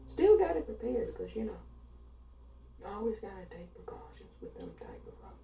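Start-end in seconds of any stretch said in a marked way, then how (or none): 2.8-5.4 whispered sinister aside; a whispered threat. Acted. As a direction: hush it like a secret warning. Confiding threat in a hushed aside.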